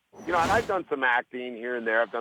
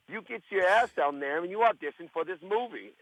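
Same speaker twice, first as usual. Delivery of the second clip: higher-pitched Yonkers manager giving orders; imitating a bossy Yonkers accent; 5 semitones higher than usual. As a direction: order them about in a Yonkers, New York accent. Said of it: "she's got this accent".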